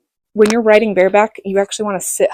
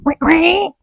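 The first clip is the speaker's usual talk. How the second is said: imitated engine noise; 9 semitones higher than usual.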